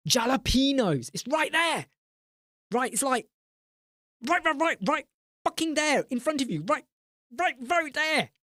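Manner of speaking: high-pitched, squealing disbelief; exasperated. Real, not acted. Streamer pointing customers to toppings right there, exasperated. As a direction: repeat it in a high, incredulous rant.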